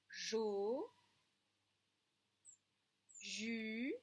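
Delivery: slow, separate syllables with J; syllabes avec J. Shown as a demonstration. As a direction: sound out each J syllable for the children. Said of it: "la dernière ligne, alors avec le J". (syllabes en J détachées)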